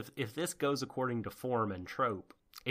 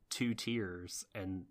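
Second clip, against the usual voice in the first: normal talking voice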